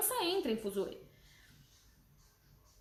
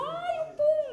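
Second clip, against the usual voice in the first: put-on much higher, louder squeal of kids dodging; imitating children; 5 dB louder, much slower than usual. Children dodging the ball, squealing in disgust. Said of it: "aí eles ficam".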